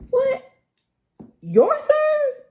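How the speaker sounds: high-pitched, incredulous retold reaction; shocked. Queen told who his sons are, shocked. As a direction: imitate a queen's stunned disbelief. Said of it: "she was genuinely shocked".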